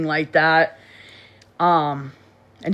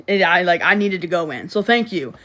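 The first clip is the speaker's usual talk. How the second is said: ordinary delivery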